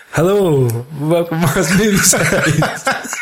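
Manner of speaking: slow, breezy mock American podcast intro; American accent. Mimicking the American podcast hosts, slow mock American accent. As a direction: ham up an American host greeting listeners. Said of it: "Do you wanna know what they sound like".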